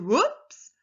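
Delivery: loud, high upward whoop; surprised and excited. Shown as a demonstration. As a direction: exclaim with a sharp rise. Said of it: "I'm sounding surprised and excited".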